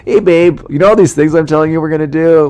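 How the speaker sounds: high, loud mock-cheery confession; sheepish call to partner. Acted call to partner with bad news.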